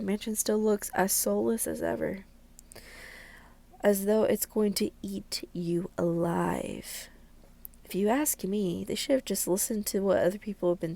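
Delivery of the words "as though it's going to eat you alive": ordinary delivery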